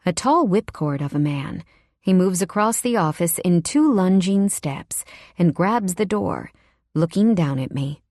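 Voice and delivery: usual speaking voice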